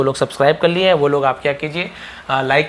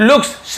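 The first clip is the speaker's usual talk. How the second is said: "looks" said high, hissing the final s; stress on the s; 9 semitones higher than usual. Shown as a demonstration. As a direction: lean on the ending of "looks". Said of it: "ऐसे ही है जोड़ देते हैं".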